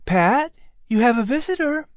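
high mother's voice calling to her son; mother announcing a guest; announcing Sarah. A narrator's reading. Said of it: "Sarah announced".

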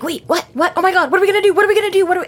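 high, rapid panicked babble; acted panic, pitch jumping way up; freaking out. Friend voicing Fix on hearing the wizard's here, freaking out. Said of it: "sounds like he's freaking out".

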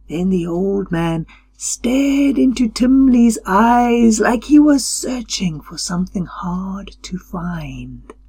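plain reading voice

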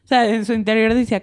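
usual speaking voice

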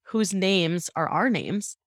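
ordinary talk, nothing marked

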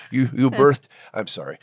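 usual speaking voice

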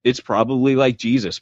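usual speaking voice

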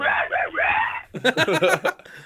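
high, loud raspy mock screams; whisper screams. Shown as a demonstration. Friends showing singing along in the shower, whisper screams. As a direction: burst of fake metal-style screaming. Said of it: "I do the whisper screams".

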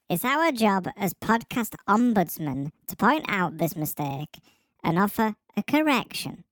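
ordinary talk

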